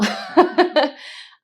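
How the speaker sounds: high, loud laugh to hold back tears; nervous laugh. Real, not acted. Guest laughing as she fights tears over her father, nervous laugh. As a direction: laugh off the emotion as it rises. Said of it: "I don't wanna get emotional".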